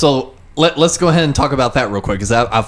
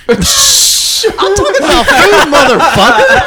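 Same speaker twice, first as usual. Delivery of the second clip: loud, high yell through laughter; high-pitched, laughing outburst; exasperated shout; 7 dB louder, slower than usual. Real, not acted. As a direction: yell in laughing protest, stretching the words. Exasperated at friend's off-topic joke.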